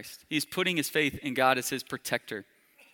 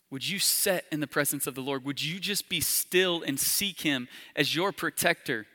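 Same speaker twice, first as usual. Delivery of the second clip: hushed altar call; 4 dB quieter than usual. Acted. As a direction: draw them in gently and quietly. Soft, slow appeal to the congregation.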